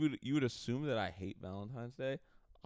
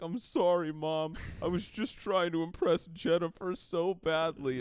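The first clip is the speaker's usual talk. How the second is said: high-pitched mock apology to mom; whiny sitcom boy owning up; pleading son; 9 semitones higher, 4 dB louder than usual. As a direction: plead like a caught kid, hammed up. Son caught stealing apologizes to mom.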